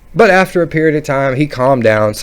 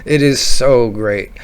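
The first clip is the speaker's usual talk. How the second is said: usual speaking voice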